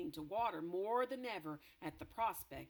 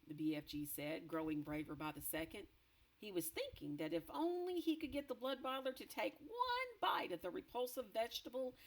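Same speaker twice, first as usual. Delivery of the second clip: ordinary delivery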